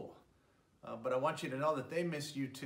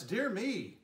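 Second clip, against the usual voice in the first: fretting mouse; 7 semitones higher than usual. Acted. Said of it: "Mouse frets".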